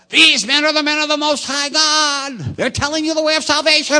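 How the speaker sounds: high, loud mocking witch voice. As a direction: sneer it like a cackling witch. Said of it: "the young girl had a witch voice".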